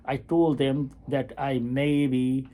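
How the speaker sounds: usual speaking voice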